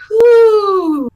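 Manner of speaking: loud, booming spectral voice demanding who intrudes; a ghost roaring from the fog at trespassers; booming ghost challenge. Acted. Ghost twins challenge the party in a loud booming voice.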